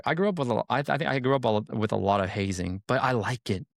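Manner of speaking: ordinary delivery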